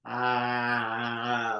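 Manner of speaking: long, sustained sighing ah; flowing open ah. Shown as a demonstration. Leader letting expression flow in an exercise, long open ah. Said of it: "increase its flow".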